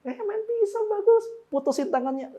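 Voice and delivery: hammed-up cheery approval; mock careless parent.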